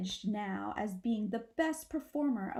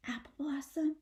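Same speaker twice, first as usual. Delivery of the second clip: ordinary delivery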